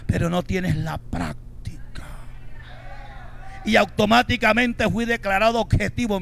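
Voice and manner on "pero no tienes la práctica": slow dramatic whisper; hushed, cutting punchline. Acted. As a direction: hiss the final blow quietly. Punchline to the teacher.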